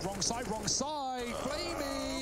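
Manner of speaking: urgent rising shout; excited shout. Real, not acted. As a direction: yell it as the play goes wrong. Caster yelling during clutch defuse.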